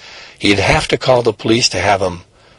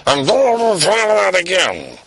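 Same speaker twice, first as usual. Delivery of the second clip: warning dad; 9 semitones higher than usual. Acted.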